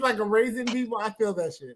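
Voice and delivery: ordinary delivery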